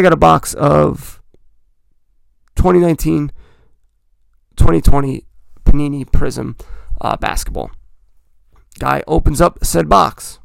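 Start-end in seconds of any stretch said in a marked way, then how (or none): none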